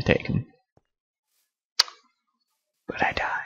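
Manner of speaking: soft whispered aside; whispering. Real, not acted. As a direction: whisper it under breath. Whispered aside after death.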